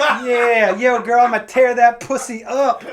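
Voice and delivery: hammed-up sleazy dude; crude message guy. Comic acting out a vulgar dating message, mock bro voice. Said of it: "just like".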